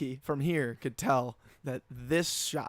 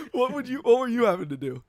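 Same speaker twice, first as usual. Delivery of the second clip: laughing; 9 semitones higher, 7 dB louder, faster than usual. Real, not acted. Laughing question.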